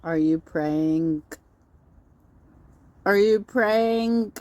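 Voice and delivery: PRAYING with a hard G sounded at the end; drawn-out PRAYING, mocking the sounded final G; slowly mock PRAYING, ending on a hard G; mocking hard-G pronunciation. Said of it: "the G is silent".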